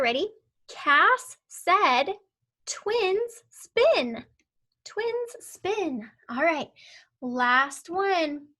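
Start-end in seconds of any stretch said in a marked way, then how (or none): 2.7-4.2 higher, sing-song reading aloud; higher read-along. Shown as a demonstration. Teacher reading along with the child in a higher voice. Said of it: "we're gonna read it together". 4.8-6.1 higher and softer echo of the phrase; higher, softer repeat. Shown as a demonstration. Teacher repeating the sentence softly for the child. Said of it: "we're gonna read it together".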